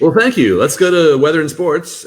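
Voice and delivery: high, loud TV newscaster; news anchor voice. Pretend. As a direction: toss to weather like an anchor. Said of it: "instead of, uh, playing along with you".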